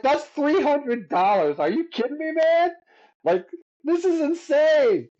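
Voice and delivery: high, stunned squeal of disbelief; cracking way up in giddy shock; freaking out. Retold or imitated. Musician hearing his gig fee, squealing in disbelief. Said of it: "I was, like, freaking out".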